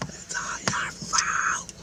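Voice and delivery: slow, strained whisper with no voice; the scream redone as a breathy whisper. Retold or imitated. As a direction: hiss the accusation like a strangled scream. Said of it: "And screamed it, like".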